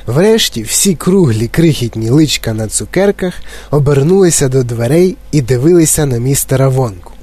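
plain reading voice

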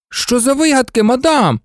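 indignant protest. A narrator's reading. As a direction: protest the unfair call, mildly outraged. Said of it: "обурився Фред".

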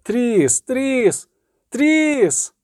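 high calls climbing on each name; gull cries. A narrator's reading. Gulls calling Triss in her vision, rising cries. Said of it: "Чайки дико кричали".

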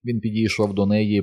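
ordinary delivery

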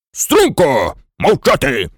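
short, stern military orders; menacing command. A narrator's reading. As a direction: order them to attention and silence. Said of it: "грізно скомандував генерал". (грізна команда генерала)

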